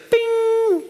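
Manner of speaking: sudden high-pitched ding; high ringing "bing". Retold or imitated. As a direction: sing out a sudden, sustained high ping. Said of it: "it's just like".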